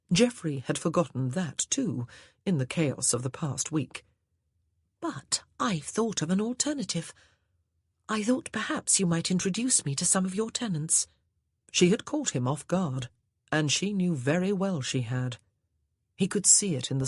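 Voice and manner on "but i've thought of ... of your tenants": put-on higher, lilting young lady; higher ladylike voice; wife's voice. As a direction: sweetly suggest the wine. Wife character speaking.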